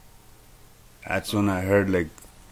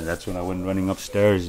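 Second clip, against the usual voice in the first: normal talking voice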